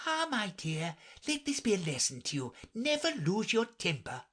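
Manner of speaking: high, lecturing mother's voice; old mother crab. A narrator's reading. Old crab to her daughter, high lecturing voice. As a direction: moralise in a high character voice.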